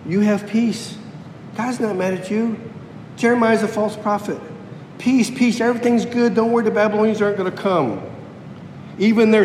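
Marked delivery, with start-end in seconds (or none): 0.1-8.0 high, mock-soothing false prophet reassurance, rushing at the end. Preacher mimics false prophets soothing the people, high mock-sweet. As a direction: parrot the false prophets' easy comfort. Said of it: "kept telling the people".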